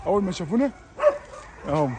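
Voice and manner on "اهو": ordinary delivery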